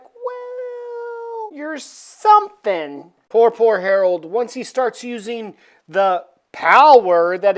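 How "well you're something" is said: villainess voice. Retold or imitated. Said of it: "And she's like".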